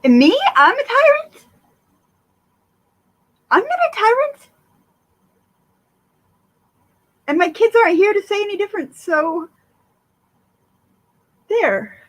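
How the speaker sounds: squeaky high mock outrage; mock indignant. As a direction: play at being offended. Mock-indignant reply to chat.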